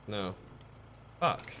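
ordinary talk

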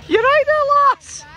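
calling out loudly; high shout across. Real, not acted. Calling out to someone.

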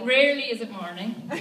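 ordinary delivery

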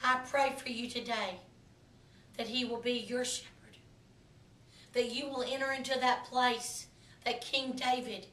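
prayer. Acted. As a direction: pray over them gently and softly. Pastor turns to quiet prayer.

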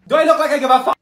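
high, loud, scornful retort; sassy comeback; rude snap. Acted. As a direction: throw it back in her face.

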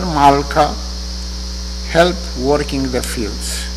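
ordinary talk, nothing marked

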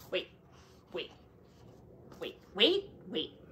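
plain reading voice

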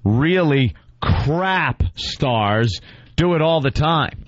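slow, drawn-out reading with weighty pauses; mock dramatic reading. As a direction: read it out as overwrought oratory. Reads the writer's piece mockingly.

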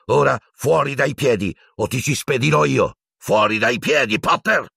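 high, enraged order; crazed shouting. Acted. Teacher ordering Potter out of the way, furious. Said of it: "strillò Piton, più folle che mai".

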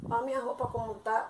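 usual speaking voice

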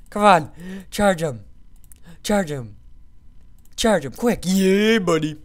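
loud, high calls to attack; excited urging. Real, not acted. Gamer telling his fighter to charge, excited shouts. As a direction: cheer your fighter into the charge.

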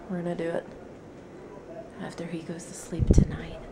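soft unvoiced whisper. Real, not acted. Whispering about sleeping baby.

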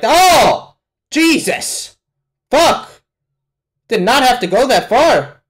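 high, loud startled exclamations; shocked outburst. Real, not acted. Viewer shocked they went that far, crying out. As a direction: burst out in real shock, pitch leaping.

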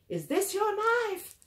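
high, drawn-out angry demand; outraged, high-pitched questioning; screaming accusation. Acted.

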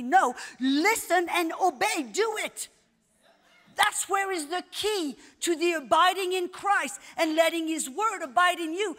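forceful, driving sermon delivery; emphatic preaching that builds to a loud burst; impassioned preaching. Real, not acted. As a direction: urge the congregation on, phrase after phrase.

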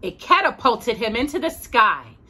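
storybook voice for a little listener; gentle read-aloud narration; reading to a child. A narrator's reading. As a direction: read it like a bedtime picture book. Reads picture book to child.

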